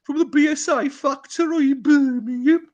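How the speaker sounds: high, loud Brummie voice, laughing; hammy Peaky Blinders Brummie; Birmingham accent. Retold or imitated. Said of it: "my Midlands accent".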